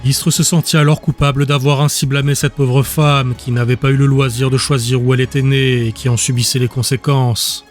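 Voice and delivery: ordinary delivery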